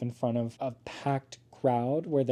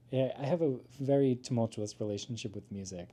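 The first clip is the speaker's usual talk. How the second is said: usual speaking voice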